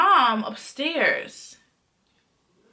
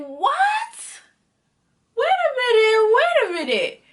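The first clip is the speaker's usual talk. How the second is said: high-pitched squealed double take; retold shocked disbelief; 11 semitones higher than usual. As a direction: shoot up high in exaggerated disbelief. Retold shocked reaction to text.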